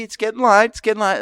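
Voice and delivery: high sing-song repeat of the phrase; theatrical high-pitched echo; mock dramatic echo. Echoing cohost, mock dramatic.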